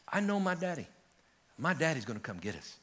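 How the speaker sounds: a hint of a small girl, soft and sure; quietly confident, like a child who trusts her dad; calm little girl. Little girl reassuring her scared friends, softly certain. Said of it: "She said this, she says".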